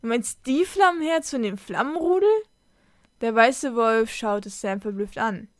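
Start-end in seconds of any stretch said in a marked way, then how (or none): 0.0-2.3 high question with rising end; astonished question. A narrator's reading. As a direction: ask higher, swinging up in amazement. Astonished girl questions the wolf.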